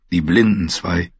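ordinary delivery, nothing marked

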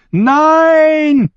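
lauter, sehr hoher, gedehnter Schrei; Aufschrei. A narrator's reading. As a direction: schrei verzweifelt auf. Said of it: "schrie sie". (gellendes, langes Schreien)

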